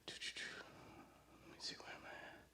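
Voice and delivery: breathy whisper under his breath; whispered to himself. Real, not acted. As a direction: mutter it to yourself, barely voiced. Muttering to himself while setting up.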